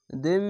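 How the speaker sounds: "দিম" with the final m hummed long; nasal ghunna. Shown as a demonstration. Teacher showing idgham with ghunna, nasal hum held. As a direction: hold the m of "দিম" through the nose. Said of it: "এখানে ঈদগামে বা গুন্না".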